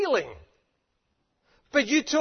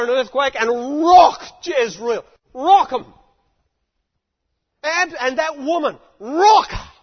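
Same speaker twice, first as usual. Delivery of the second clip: Elijah's furious demand; 4 semitones higher, 11 dB louder than usual. Retold or imitated. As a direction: rage at God to destroy her. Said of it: "all Elijah saw".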